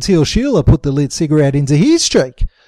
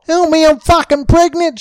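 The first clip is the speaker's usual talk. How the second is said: high, raised plea; yelled; 11 semitones higher, 4 dB louder than usual. A narrator's reading. Sheila banging on the door, yelling high for help. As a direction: beg loudly at the door. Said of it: "she yelled".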